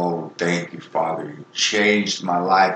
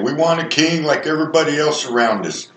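put-on higher, fast, childish demanding voice; mock whiny Israelites; 7 semitones higher, faster than usual. Preacher mimicking the Israelites demanding a king, high fast whine. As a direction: whine it like a spoiled crowd. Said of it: "Remember when the Israelites said".

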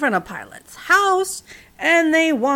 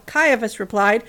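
ordinary delivery, nothing marked

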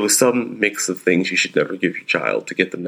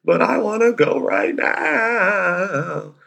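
high, very slow whiny wail; imitating crying child; 12 semitones higher, much slower than usual. Dad mimics son crying to go to school right now, high slow wail. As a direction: stretch it out like a sobbing toddler. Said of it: "Cried the whole way home".

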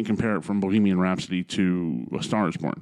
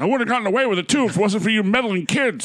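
high, hammy Scooby-Doo villain; cartoon villain voice; 11 semitones higher, 3 dB louder, faster than usual. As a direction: snarl it like an unmasked crook.

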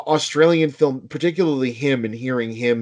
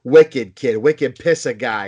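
put-on a touch of a Boston accent, loud on "Wicked kid"; half-hearted Boston accent, like after a few drinks; 5 dB louder, slower than usual. Shown as a demonstration. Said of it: "we're known for our accents".

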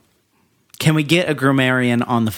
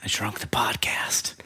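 soft whispered title; whispering; 3 semitones lower, 10 dB quieter than usual. Pretend. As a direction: whisper it like a secret.

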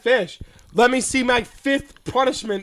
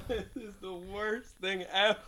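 ordinary delivery

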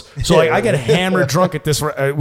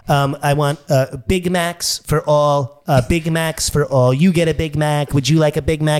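Donald Trump. Acted. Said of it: "I got Trump and Fat Bastard".

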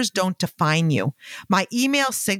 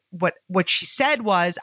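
usual speaking voice

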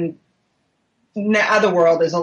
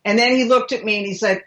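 usual speaking voice

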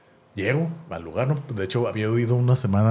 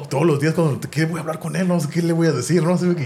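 excited inner worry; 6 semitones higher than usual. Retold or imitated. Fan rehearsing what to tell his idol, excited and higher. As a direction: babble it nervously, pitched up. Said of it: "Yo estaba acá bien emocionado".

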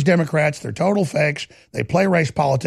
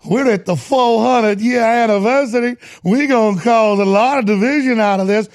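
higher, louder, exaggerated Southern gloating; gloating mock politician; 6 semitones higher, 3 dB louder than usual. Host mocks the politician gloating over the anniversary in a higher, louder voice. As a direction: relish every word like a smug schemer. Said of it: "He relishes and gets all big-eyed".

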